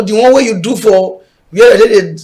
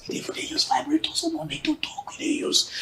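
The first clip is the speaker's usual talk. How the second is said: whispered aside; 7 semitones higher, 17 dB quieter than usual. Real, not acted. Cheeky aside about vibrator.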